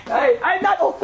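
high-pitched comic protest; protesting old man. Retold or imitated. Abraham joking that he isn't old, high mock protest. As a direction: object in a high voice, half laughing.

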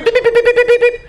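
very high, insistent beeping; imitated warning beeps. Inner Mobileye warns a person veering, high imitated beeps. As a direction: mimic an alarm in rapid high bursts.

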